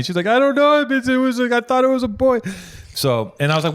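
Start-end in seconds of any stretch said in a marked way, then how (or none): 0.3-2.4 very high, loud imitated wail; hammed-up sobbing voice, pitched way up; mock crying. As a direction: mimic a tearful ex wailing. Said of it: "she started crying".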